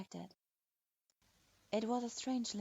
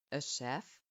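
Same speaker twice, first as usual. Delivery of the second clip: much lower, scornful disbelief in the stern man's voice; incredulous; 7 semitones lower than usual. Acted. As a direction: repeat it in flat disbelief. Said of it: "sounding rather incredulous".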